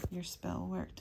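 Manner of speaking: ordinary talk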